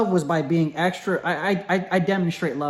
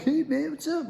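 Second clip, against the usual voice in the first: high, soft mock of guys acting cool; faux-cool greeting; 11 semitones higher, 5 dB quieter than usual. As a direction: imitate a too-friendly guy, pitched way up. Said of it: "like they're cool".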